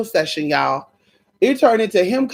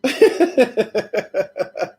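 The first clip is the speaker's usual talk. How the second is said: high laugh; 5 semitones higher than usual. Real, not acted. Laughing at own joke.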